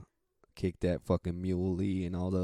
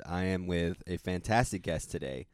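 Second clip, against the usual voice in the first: ordinary delivery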